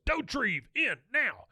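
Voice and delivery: high loud command; barked order. Retold or imitated. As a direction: shout the order across the room. Officer orders Bill out.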